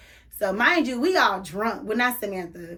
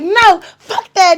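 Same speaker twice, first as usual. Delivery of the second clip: loud high refusal, drawn out slow; retelling her shouted refusal to the cop; defiant shout; 9 semitones higher, 9 dB louder, slower than usual. Retold or imitated. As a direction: yell it slowly, daring them. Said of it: "I do remember saying that".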